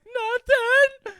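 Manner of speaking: high, loud wail; hammed-up crying squeal; mock crying kid. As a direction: bawl it out like a tearful child. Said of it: "I'm crying".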